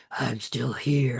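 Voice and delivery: low, slow menacing villain voice; evil voice. Retold or imitated. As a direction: growl it slow like a lurking evil. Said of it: "that evil voice that's like".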